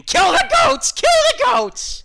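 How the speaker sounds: put-on high-pitched, loud child's scream; screaming kid. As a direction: scream it out like a panicked, injured kid. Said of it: "He runs out of the barn screaming".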